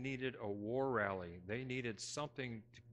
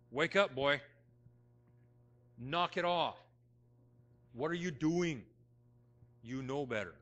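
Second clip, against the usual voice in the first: loud, stern voice of God scolding; stern rebuke; 2 semitones higher, 8 dB louder than usual. Retold or imitated. The Lord scolding the preacher in his head, loud stern rebuke. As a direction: bark it like a father catching his boy. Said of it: "the Lord talks to me in a stern way".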